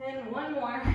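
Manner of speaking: ordinary talk, nothing marked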